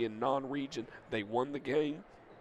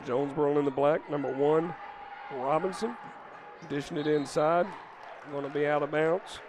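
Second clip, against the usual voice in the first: play-by-play call; 5 semitones higher than usual. Acted. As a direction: call the action to the crowd.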